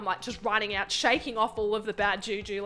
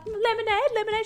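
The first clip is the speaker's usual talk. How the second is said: put-on old lady offering; 12 semitones higher than usual. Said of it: "She was like".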